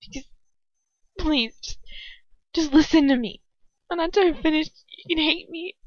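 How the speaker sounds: high, pleading, choked with tears; tearful. A narrator's reading. Hermione begging Ron to let her explain, tearful. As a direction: beg desperately, crying as you go. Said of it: "tears streaming down my face".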